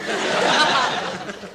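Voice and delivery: high shrieking laugh. Real, not acted. Laugh at the joke.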